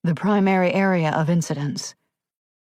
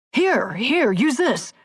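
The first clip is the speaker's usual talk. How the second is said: urgent, frantic; 7 semitones higher, slower than usual. A narrator's reading. Rookie urgently offers his shirt.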